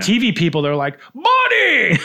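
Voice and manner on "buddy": high, loud, overfamiliar greeting; mock familiar holler. TV fans greeting a star like an old friend, mock hollering.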